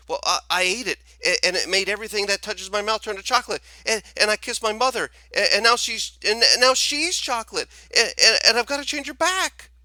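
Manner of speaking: very high, loud, fast stammer rising to near screaming; a boy in panic pouring it all out; panicked babbling. Acted. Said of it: "he babbled on".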